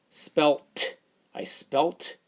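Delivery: spelt ending in a T sound; spelled with a t. Shown as a demonstration. Teacher trying the t ending on spelled. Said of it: "No, it doesn't sound right".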